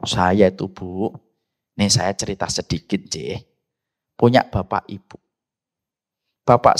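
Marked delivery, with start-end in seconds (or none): none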